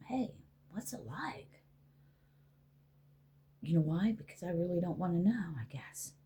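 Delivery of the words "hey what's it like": soft, slow, breathy whisper; hushed, slow, curious whisper; imagined whisper. Retold or imitated. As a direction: whisper the question as if afraid of the answer. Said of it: "I've never once asked them".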